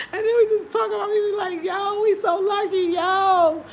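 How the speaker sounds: talking high and loud through laughter; can barely get the words out for laughing; real giggling speech. Real, not acted. Laughing while telling.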